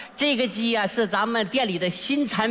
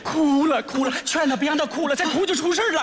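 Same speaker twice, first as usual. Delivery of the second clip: high, flustered pleading; alarmed urging; 5 semitones higher than usual. Acted. Manager begs young man to stop the customer crying, high and alarmed. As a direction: plead in rising panic.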